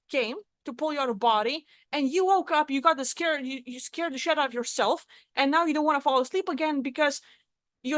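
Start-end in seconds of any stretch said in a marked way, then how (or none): none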